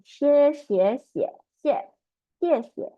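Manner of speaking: 谢 said xiè, high and loud falling; fourth tone xie. Shown as a demonstration. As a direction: drill 谢 with a sharp high fall. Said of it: "fourth tone".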